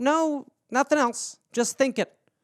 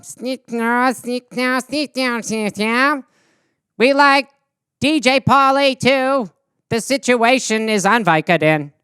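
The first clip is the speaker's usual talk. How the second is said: alien voice; 2 semitones higher, slower than usual. Acted. Alien asks for Jersey stars.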